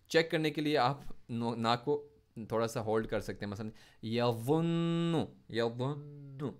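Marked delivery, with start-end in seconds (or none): 4.0-6.5 यज़ुन्नु slow with the न्न held through the nose; nasal held noon. Shown as a demonstration. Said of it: "नून नाक से निकल रहा है". (यज़ुन्नु में नून नाक से रोककर)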